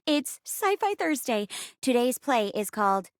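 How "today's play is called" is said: ordinary talk, nothing marked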